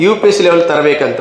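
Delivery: ordinary delivery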